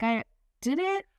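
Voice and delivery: high, baffled question; clueless surprise. Retold or imitated. Dennis hearing he cried on TV, baffled. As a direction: play dumb, genuinely puzzled. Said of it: "And Dennis was like".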